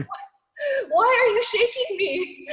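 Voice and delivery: high, slow, laughing confusion; dazed, laughing. Retold or imitated. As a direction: laugh through a groggy protest. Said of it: "I was like".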